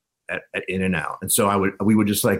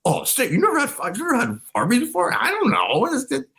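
very high-pitched mock-baffled voice. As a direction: squeal the questions as a puzzled bandmate impression. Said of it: "Stigma going like".

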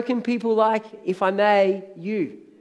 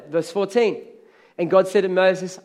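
ordinary delivery

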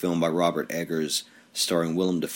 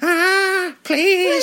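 falsetto plea, much higher and louder; high squeal; 6 dB louder than usual. Pretend. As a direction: squeal a pleading please. Mock pleading squeal.

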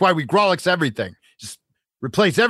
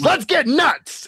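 movie quote; 11 semitones higher, much slower than usual. Retold or imitated. Quoting a movie line.

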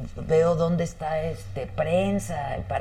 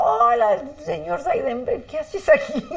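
higher, laughing mock greeting; cheeky greeting; 9 semitones higher than usual. Greeting the commission president as if it were nothing, high and laughing. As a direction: play it breezy, like meeting an old friend. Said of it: "y yo".

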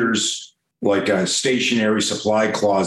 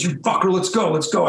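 higher-pitched imitation of a goading kid; retold angry challenge; 6 semitones higher than usual. Embarrassed classmate goading him to fight, angry. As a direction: act out an angry dare. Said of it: "He's embarrassed and he's angry".

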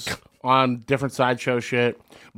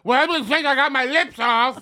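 mock body-mod guy; 13 semitones higher than usual. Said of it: "He's gonna be like".

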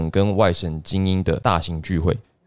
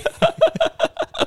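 high burst of laughter. Real, not acted. Laughing at the joke.

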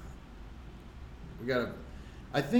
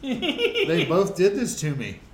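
laughing; 7 semitones higher, 8 dB louder, slower than usual. Real, not acted. Laughing at being high.

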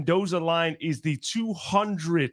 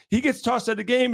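usual speaking voice

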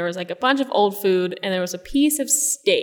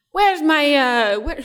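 mock Russian stepmother, higher and louder; higher, louder half-attempt at a Russian accent; 7 semitones higher, 5 dB louder, slower than usual. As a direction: start a Russian accent, then lose it. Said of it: "can't even do an, a Russian accent right now".

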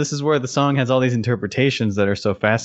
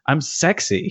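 mock sexy; 6 semitones higher than usual. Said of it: "sorry, you might wanna cover your ears".

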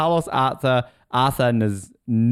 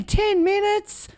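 exasperated; much slower than usual. Real, not acted. Exasperated at long speech.

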